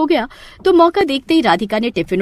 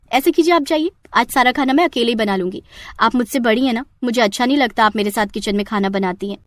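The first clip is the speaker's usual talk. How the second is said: normal talking voice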